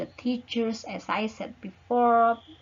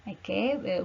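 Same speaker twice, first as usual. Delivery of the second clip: ordinary delivery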